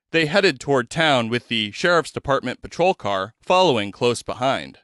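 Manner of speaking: ordinary delivery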